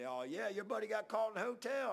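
high, loud jeering jab; mocking taunt. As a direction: needle him with a sneering high taunt. Coworkers mocking him about Swaggart.

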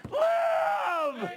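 a very high, loud cry of Live; soaring shouted cry. Acted. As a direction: let it ring out as the command that saves. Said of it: "He said".

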